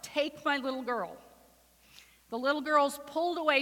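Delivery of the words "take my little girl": usual speaking voice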